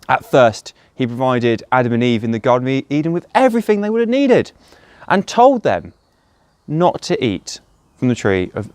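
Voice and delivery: normal talking voice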